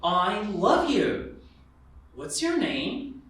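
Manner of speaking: ordinary delivery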